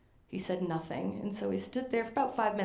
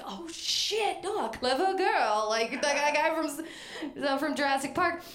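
starstruck panic; 6 semitones higher, slower than usual. Retold or imitated. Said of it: "and I was like".

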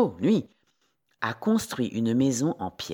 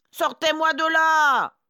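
high, loud wolf's cry for help; howled yell; 9 dB louder than usual. A narrator's reading. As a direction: yell from down in the well. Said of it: "hurle le loup". (hurlement du loup, aigu et fort)

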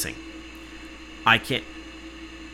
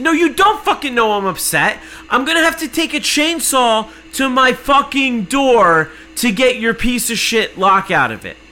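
much higher and louder furious yelling rant; furious shout; 12 semitones higher, 8 dB louder than usual. Retold or imitated. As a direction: scream it at the useless support agent. Yelling back at apologizing rep.

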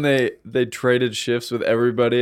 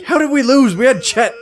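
baffled complaint; 10 semitones higher than usual. Retold or imitated. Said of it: "he'd be like".

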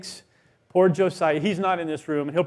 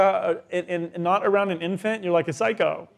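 normal talking voice

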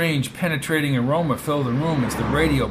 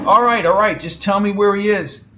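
loud, higher-pitched insistence; impatient concession; 6 semitones higher, 6 dB louder than usual. Acted. Brady giving in to Peg's plea, impatient. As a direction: cut short her pleas. Brady gives in, presses again.

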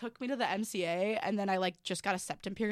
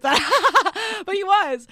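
giggling through the words, higher and louder; laughing; 11 semitones higher, 11 dB louder, much slower than usual. Real, not acted. Laughing while telling a friend the story. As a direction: laugh it out in disbelief.

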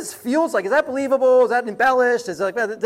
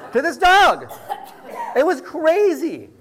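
words spoken through a real laugh, higher; 9 semitones higher, 4 dB louder, slower than usual. Real, not acted. Pastor telling his congregation about the dog, laughing. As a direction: still laughing at the story.

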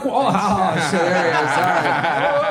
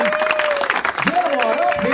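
announcer call; 10 semitones higher, much slower than usual. Acted. Host calling guests up.

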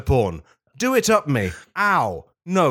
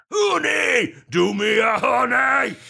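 raging Pooh; 13 semitones higher, slower than usual. Acted. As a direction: demand the honey like a furious bear. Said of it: "raged Pooh".

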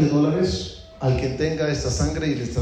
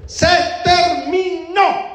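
very high, drawn-out yell; full-lung shout; 3 dB louder, much slower than usual. Acted. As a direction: bellow each syllable, high and long. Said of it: "y la grita". (grito agudo a todo pulmón)

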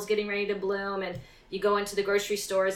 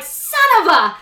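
loud, high-pitched frustrated cry; mock exasperation; 10 semitones higher, 12 dB louder than usual. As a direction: curse the avocado in hammed-up outrage. Acted frustration at bad avocado.

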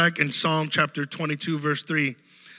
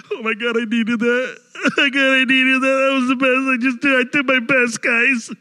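much higher, louder, laughing rapture; ecstatic inner squeal; 9 semitones higher, 11 dB louder than usual. Retold or imitated. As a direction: burst with joy you were appreciated. Inner delight after being thanked.

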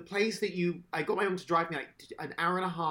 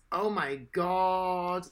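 ordinary talk, nothing marked

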